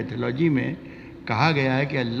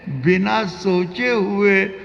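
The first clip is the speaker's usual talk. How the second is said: high, slow, drawn-out recitation; ghazal recitation; 9 semitones higher, 5 dB louder, much slower than usual. Acted. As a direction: lift the verse high and let it ring.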